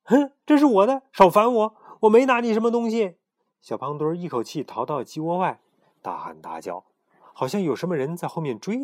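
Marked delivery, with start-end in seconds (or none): 0.0-3.2 high, loud defiant yelling; high, loud defiant shout. Acted. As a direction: shout him off, high and stubborn. Little chick defiantly refuses pharaoh.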